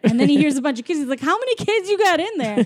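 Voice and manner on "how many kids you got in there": much higher retold question; mock astonishment. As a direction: imitate amazement, voice pitched way up. Acting Sully's surprised question.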